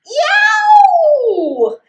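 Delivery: high, loud pained yelp. Acted. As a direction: cry out as the tail gets bitten. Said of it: "yelled Beaver".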